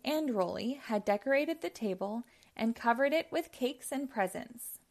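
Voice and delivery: ordinary delivery, nothing marked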